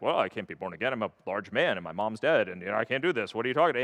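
lightly voiced flustered scholar; baffled Nicodemus. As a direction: play the confused wise man protesting. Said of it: "And Nicodemus is like".

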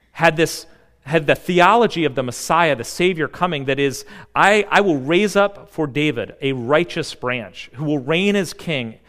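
full-force pulpit preaching. Real, not acted. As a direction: build it with conviction. Sermon building to full force.

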